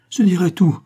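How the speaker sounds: high, loud frightened capitulation; craven, panicky promise; cowardly surrender. A narrator's reading. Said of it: "murmura le nègre, aussi lâche que féroce".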